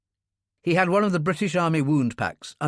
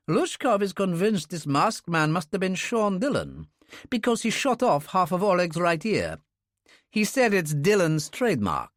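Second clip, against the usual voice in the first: lightly raised, earnest friend's voice; Russian man voice; 7 semitones higher than usual. A narrator's reading. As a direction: voice him as a sincere old comrade. Bounine reports.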